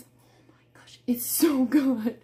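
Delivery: breathless whisper of delight; whispered gush. Real, not acted. Seller whispering to viewers about her favorite flavor. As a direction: whisper it like a guilty pleasure. Gushing over favorite drink.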